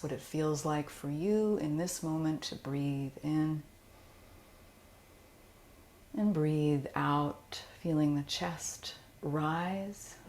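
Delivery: calm, soothing Yoga Nidra guidance; guided meditation. Acted. Teacher guiding listeners through Yoga Nidra, calm meditation voice.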